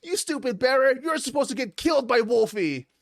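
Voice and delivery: put-on very high, whiny child voice; mock a bratty child's outraged wail; high-pitched indignant kid; upset kid. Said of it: "the kid's just like".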